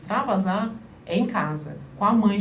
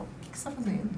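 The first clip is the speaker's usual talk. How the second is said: put-on gentle, softer question at child level; soft crouched-down question; 9 dB quieter, faster than usual. Shown as a demonstration. Mother kneeling to ask her child, softly. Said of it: "Você baixa, conversa, fala".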